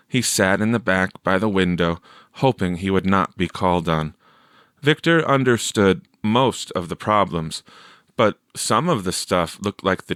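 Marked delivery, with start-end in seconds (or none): none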